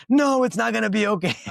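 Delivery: hammed-up distraught sitter, shrill cry; mock panicked wail. Sitter's panicked reply, retold.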